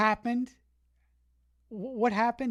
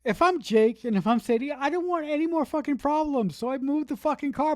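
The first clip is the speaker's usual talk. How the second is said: exasperated; 6 semitones higher, 4 dB louder than usual. Retold or imitated. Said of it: "I'm like".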